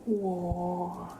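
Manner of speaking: high drawn-out whoa of wonder; amazed whoa. Real, not acted. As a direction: marvel at the cat's close-up, way up high. Cat close-up on camera.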